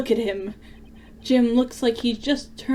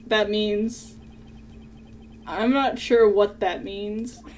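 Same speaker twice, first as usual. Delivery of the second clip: ordinary talk, nothing marked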